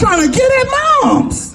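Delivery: high incredulous shout; high-pitched disbelief at the memory; excited. Real, not acted. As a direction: voice jumps way up, amazed.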